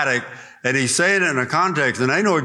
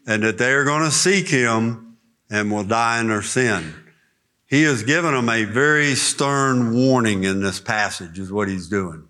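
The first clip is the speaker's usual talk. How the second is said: normal talking voice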